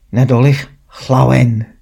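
Nadolig Llawen said the Welsh way; a Christmas wish spoken in Welsh; Welsh greeting. Shown as a demonstration. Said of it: "ask someone Welsh what that means".

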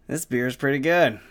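ordinary talk, nothing marked

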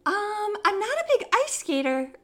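high, hesitant excuse; hesitant hedging. Retold or imitated. Retelling turning down skating to the date, hesitant. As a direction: pitch it up to dodge politely.

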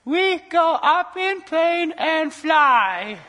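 high, loud, slow silly voice; comic high-pitched bad captain; goofy incompetent pilot. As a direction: drag it out like a clueless pilot on the intercom. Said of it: "if you had someone who goes".

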